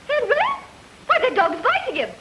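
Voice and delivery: fussy wife scolding Henry; mother's voice. Pretend. Woman stalling a detective in her radio act, as a worried mother. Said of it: "I play all the voices".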